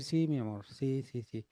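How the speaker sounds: calm him with soft tenderness; tender reassurance. Shown as a demonstration. Wife calming her angry husband, soft and tender. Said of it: "a decir".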